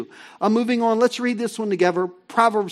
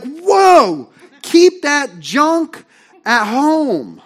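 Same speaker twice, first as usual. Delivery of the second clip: higher and louder, a slow drawn-out whoa; startled loud recoil; 6 semitones higher, 8 dB louder, much slower than usual. Acted. Churchgoer recoiling from the coughing greeter, startled and loud. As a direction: push the sick greeter away in mock alarm. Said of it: "You're like".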